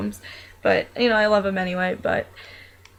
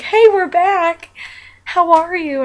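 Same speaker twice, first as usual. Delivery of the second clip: put-on higher, slow little girl greeting; slow, higher child's hello; 8 semitones higher, 5 dB louder, slower than usual. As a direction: pitch it up like an eager little girl.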